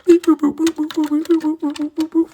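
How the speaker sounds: high, loud beep-boop noises; machine beeps. Acted. Traveller working the time machine, high beep-boops. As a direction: sing out the controls like a machine.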